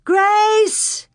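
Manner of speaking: high, loud calls from the doorway; calling. A narrator's reading. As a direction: call her name out wide.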